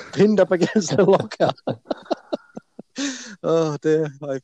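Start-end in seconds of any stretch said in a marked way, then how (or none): 1.6-3.2 a breathless high-pitched giggle fit; high squeaky laugh. Real, not acted. Laughing at his own story about the shower, high squeaky giggle. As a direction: laugh helplessly, high and airy.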